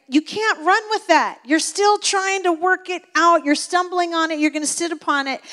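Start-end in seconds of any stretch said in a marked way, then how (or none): none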